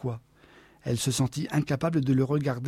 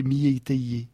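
usual speaking voice

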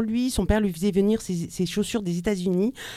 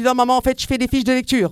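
higher, louder, blurting it all out; blurted confession; 5 semitones higher, 6 dB louder than usual. Retold or imitated. Caught by mum over the notebook, blurting out the truth. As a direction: let the truth burst out, pitched up and loud. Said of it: "Voilà, c'est sorti tout seul".

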